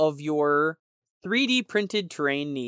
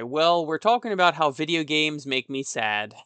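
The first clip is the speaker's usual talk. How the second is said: usual speaking voice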